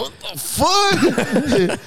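high, very slow, drawn-out startled cry; stretched English curse in a put-on voice; imitated startled man. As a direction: wake up mid-flight in shock. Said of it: "y luego el negrito".

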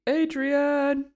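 high, drawn-out Adrian call; mock movie yell at ordinary volume; Rocky's cry. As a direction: lightly mimic Rocky calling out, stretching the name. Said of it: "Rockin hahmo huutaa".

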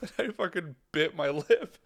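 high, giggly telling through laughter; laughing at oneself. Real, not acted. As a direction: try to explain while cracking up. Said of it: "I'm laughing at myself".

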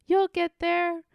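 singsong imitation of encouragement; reassuring commenters. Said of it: "everyone's like".

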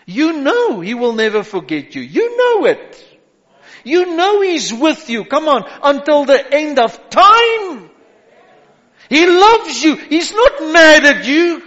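high, loud preacher's cry; preaching at full force. Acted. Pastor assuring the congregation, in full preaching cry. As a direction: lift the church with a ringing call.

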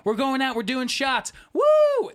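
put-on high, excited party-girl call rising to a whoop; woo girl. Self-confessed woo girl going out for shots, high whooping. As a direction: hype up the night out, then whoop. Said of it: "on the record being a woo girl".

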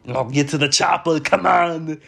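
put-on Austrian action-hero accent, clipped and heavy; Arnold Schwarzenegger impression. Doing his Arnold impression for the podcast listeners, Austrian accent. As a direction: growl it out like an Austrian action star. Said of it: "the Arnold one is pretty funny".

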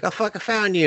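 high-pitched mock excitement; retold excited discovery. Girl surprising him at his school, mock excited. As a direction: pitch it way up, imitating her find.

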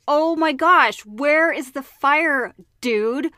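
hammed-up outrage at the jerk who cut in; road rage. Retold road rage at driver.

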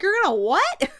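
high, loud disbelieving question; mock incredulity. Kate hearing he'll suck out the sting, incredulous. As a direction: shoot up in outraged surprise.

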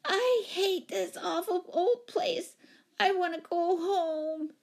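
put-on high, slow childish howl. A narrator's reading. Small Betsy hating the new place and wanting home, howling. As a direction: wail it long and high like an upset little girl. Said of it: "Betsy opened her mouth wide and howled".

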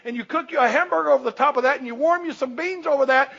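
ordinary delivery

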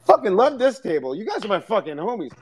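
high, excited crowd-work voice; hyped comedian at a crowd. Retold or imitated. Comedian to a lively table, imitated high and excited. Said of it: "And they're like".

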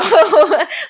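high, loud, bright burst of laughter; quick real laugh. Real, not acted. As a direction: laugh out loud at your own joke. Laughing at own joke.

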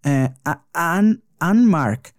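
retold faltering answer, broken by false starts; imitated hesitant stammer. Homeless man shyly giving his name to the preacher, imitated stammering. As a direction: stumble haltingly into the name, imitating him. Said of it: "prácticamente titubeando me dice".

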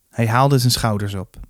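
usual speaking voice

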